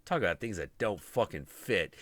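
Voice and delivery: normal talking voice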